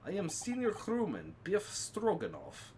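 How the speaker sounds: lightly voiced Russian sailor, broken English; a hint of a stern Russian crewman; gruff Russian sailor. Sailor introduces himself.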